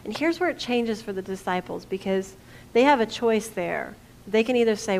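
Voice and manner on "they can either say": usual speaking voice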